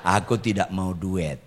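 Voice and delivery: low, slow, softened plea to God; hushed and measured, as if praying inwardly; mock-meek prayer. Silent husband praying while his wife rants, hushed and meek. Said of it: "Saya bilang sama Tuhan".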